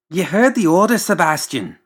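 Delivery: high, loud, bossy mad-doctor voice; pompous doctor. Acted. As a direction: order the servant about from up high.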